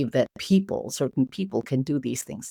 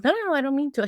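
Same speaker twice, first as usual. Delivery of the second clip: much higher, hurried no-no-no; flustered reassurance; 12 semitones higher, much faster than usual. Retold or imitated. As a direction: quickly wave off the apology. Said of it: "And it's like".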